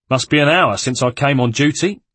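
lightly voiced old lamplighter; a hint of a squinting old workman.

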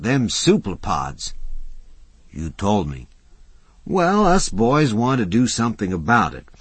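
lightly voiced tough guy; rough working man. Mac voiced by narrator.

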